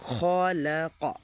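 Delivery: খলাকা read slowly with long drawn vowels. Shown as a demonstration. Teacher reading slowly so students can follow. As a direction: stretch খলাকা out carefully. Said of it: "খলাকাটা দেখেন কত তাড়াতাড়ি পড়লাম".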